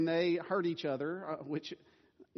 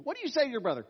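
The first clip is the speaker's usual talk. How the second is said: stern dad prompt; 9 semitones higher, 4 dB louder, much faster than usual. Retold or imitated. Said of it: "I will say to them".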